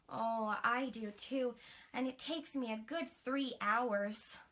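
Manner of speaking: ordinary delivery, nothing marked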